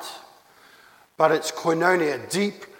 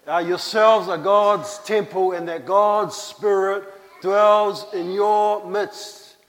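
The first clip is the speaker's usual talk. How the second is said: ordinary talk, nothing marked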